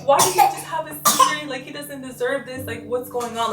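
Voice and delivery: high, tearful, distraught pleading; retold distraught crying. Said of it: "I was, like, crying".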